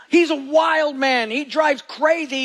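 booming declaration about the wild driver; emphatic exclamation. Real, not acted.

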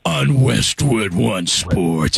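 slow promo voice sinking very low; deep announcer voice. Acted. Host mimicking a Monday Night Football promo, deep announcer voice. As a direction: stretch out the football promo, dropping deep at the end. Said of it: "I don't have a deep enough voice".

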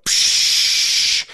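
short hissing psh of air brakes. Retold or imitated. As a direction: imitate a truck's air brakes. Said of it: "it sounded like the air brakes".

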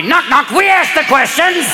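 caricatured German interrogator telling a joke; German comedian. Acted. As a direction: demand it in a hard, slow German accent. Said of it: "German comedy".

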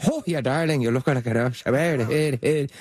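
hammed-up, garbled Irish brogue; mock Irish accent. Actor mocks co-star's attempt at Irish, in a mock Irish accent. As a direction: imitate a badly done Irish accent. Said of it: "he wants to do an Irish accent".

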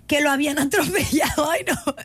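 can't hold back a laugh reading it; breaking into laughter. Real, not acted. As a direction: laugh bursts out mid-word. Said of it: "me dio mucha risa". (se le escapa la risa)

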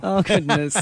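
high laughing exclamation. Real, not acted. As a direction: laugh it off in delight. Laughing at the American version.

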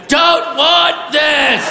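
slow, high-pitched cry of protest; blurted wail. Acted. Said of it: "just walked up and went".

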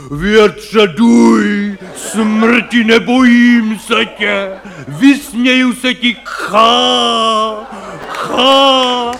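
mock heroic stage declamation with a scornful ha ha; hero bellowing. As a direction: roar at the wind like a stage hero, slow and grand. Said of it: "křičejí tam, prostě řvou".

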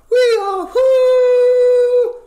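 very high, loud electronic wail; laser beam sound. Acted. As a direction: fire a screeching laser zap. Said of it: "went my stimulated emission laser beam".

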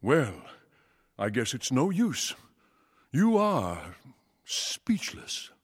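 disappointed father. Acted. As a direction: give up on your son with understated letdown. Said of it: "how disappointed his father was".

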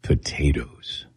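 slow, low whispered imitation of the doctor; doctor's slow hush. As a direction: drag out the word like the doctor did. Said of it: "And he talked like this".

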